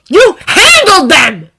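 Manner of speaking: loud, slow furious yell. A narrator's reading. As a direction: yell each word. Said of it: "Bakugo yells".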